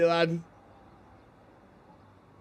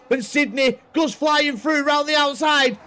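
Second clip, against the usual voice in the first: combat callout; 12 semitones higher, 5 dB louder than usual. Real, not acted. Calling the overtake.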